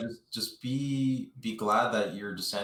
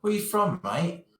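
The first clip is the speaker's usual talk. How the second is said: British bloke; 10 semitones higher, 3 dB louder than usual. Retold or imitated. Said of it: "He's like".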